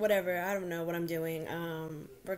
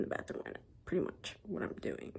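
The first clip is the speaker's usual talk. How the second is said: whispering; 2 semitones lower, 7 dB quieter than usual. Real, not acted. Whispering in doctor's bathroom.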